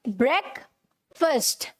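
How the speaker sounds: ब्रेकफास्ट as "brek... fust", slowly; correct, broken up. Shown as a demonstration. Teacher asking what it is, ब्रेकफास्ट slowly as "brek... fust". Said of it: "जैसे ये लिखा है ना".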